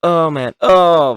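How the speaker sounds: grossed-out groan. Real, not acted. Grossed out at surgery.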